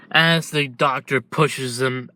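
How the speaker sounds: plain reading voice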